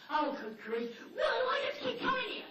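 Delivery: loud high exasperated outburst; fed up with the barking, shout it; exasperated owner. Retold or imitated. Owner yells at dogs, loud and exasperated.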